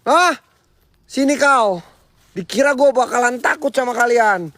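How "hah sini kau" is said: high, defiant call to the spirit; taunting challenge. Acted. Ghost hunter daring the spirit in the dark, high defiant taunt. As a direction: dare the ghost to show itself.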